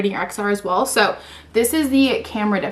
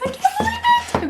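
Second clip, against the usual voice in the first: much higher, squealing through a laugh; giddy laughing disbelief. Real, not acted. Seeing the phone.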